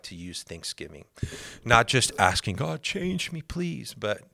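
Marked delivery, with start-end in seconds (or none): 2.6-3.9 high, pleading whine; begging prayer. Retold or imitated. A believer begging God to change him, high pleading whine. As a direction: plead with God like a helpless beggar.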